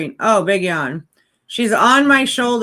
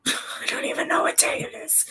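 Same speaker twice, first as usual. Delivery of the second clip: whispered aside; 9 dB quieter than usual. Real, not acted. Laughing aside to herself.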